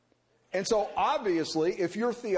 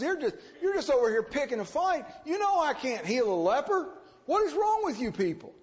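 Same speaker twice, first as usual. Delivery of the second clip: higher, exasperated outburst of a fed-up king; 6 semitones higher than usual. Retold or imitated. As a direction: throw up your hands in disbelief. King tears clothes, exasperated.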